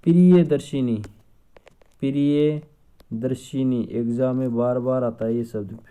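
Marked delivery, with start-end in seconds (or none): 0.1-1.0 Priyadarshini called out loud and firm, falling at the end; emphatic. Shown as a demonstration. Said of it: "देखो, एक शब्द लिखा जाता है". 2.0-3.8 Priyadarshini drawn out syllable by syllable, very slow; slow spelling-out. Shown as a demonstration. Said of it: "देखो, एक शब्द लिखा जाता है".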